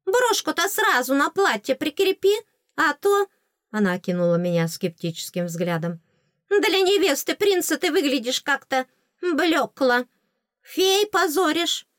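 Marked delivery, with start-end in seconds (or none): 0.0-3.3 high, insistent fairy voice; bossy fairy. Acted. Fairy tells heroine to pin the brooch, high bossy. 6.5-11.9 high fairy voice, drawn out at the end; critical fairy. Acted. Fairy criticizes the prince's bride, high disapproval.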